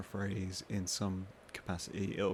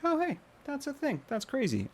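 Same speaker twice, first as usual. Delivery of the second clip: mock wide-eyed discovery, pitched way up; intrigued amazement. Viewer stumbling on carpentry videos, high-pitched amazement. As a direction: act out a viewer suddenly fascinated, high-pitched.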